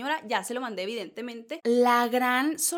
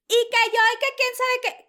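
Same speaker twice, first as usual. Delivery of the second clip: high, loud mock shouting; pitched-up shouted parody of squabbling hosts; mock yelling; 11 semitones higher, 6 dB louder than usual. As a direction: imitate hosts screaming over each other. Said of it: "andaban grite y grite".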